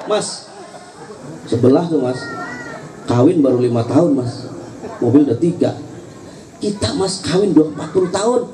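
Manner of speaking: lightly voiced wife comparing him to the neighbour; nagging wife. Acted wife nagging her husband. (istri mengomel ke suami, suara lebih rendah)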